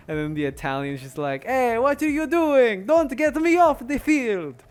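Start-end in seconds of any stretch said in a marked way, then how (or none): 1.4-4.6 much higher and louder, slow, hammed-up Italian player; mock Italian shout. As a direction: yell it as an outraged Italian footballer.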